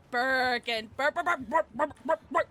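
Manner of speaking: calling out. Real, not acted. Streamer calling the cat Birkin, stammered rising call. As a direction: call the cat's name, stuttering in a rising build.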